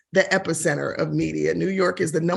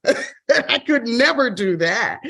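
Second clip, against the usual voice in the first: louder, higher retold "oh no", then a laugh; retold refusal; 4 semitones higher, 4 dB louder than usual. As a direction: balk at the idea, laughing afterwards. Retelling her refusal of radio idea.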